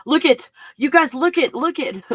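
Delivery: high, loud eager urging; can't wait to show it; excited. Real, not acted. Vlogger urging viewers to look, high and eager. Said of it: "Okay, look".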